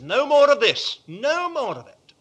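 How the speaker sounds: a booming divine decree; God's firm command. Retold or imitated. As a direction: thunder it as God putting a stop to it. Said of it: "there comes a point when God says".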